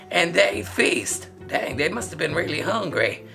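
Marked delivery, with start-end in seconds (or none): none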